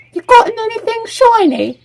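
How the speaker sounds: very high squawking bird voice; squawky bird. Acted. Bird squawking at a stranger for shiny things, very high. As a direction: screech it like a greedy magpie.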